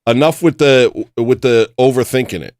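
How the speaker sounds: loud self-lecture; mock self-pep talk. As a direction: scold yourself to move on. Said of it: "be like".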